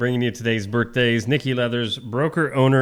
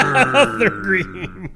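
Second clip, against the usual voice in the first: laughing mock announcer; 6 semitones higher, much slower than usual.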